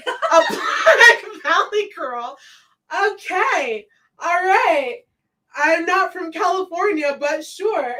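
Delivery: high-pitched, laughing disbelief; incredulous retort. Retold or imitated. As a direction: echo it back high and loud, taken aback. Retold incredulous reply to remark.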